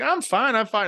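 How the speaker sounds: high, sing-song "I'm fine"; mock drunk insistence. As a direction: pretend you're fine while tipsy. Drunk at beer festival.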